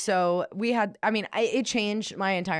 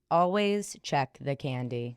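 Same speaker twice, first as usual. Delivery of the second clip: ominous title read; 4 semitones lower, slower than usual. Shown as a demonstration.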